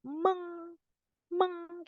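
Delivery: Meng said high and soft; stressed prefix. Shown as a demonstration. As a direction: float meng up high and quiet.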